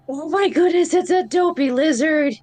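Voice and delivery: high-pitched excited gushing; excited exclamation. Acted. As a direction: gush over an unexpected little creature. Character delighted by a lizard.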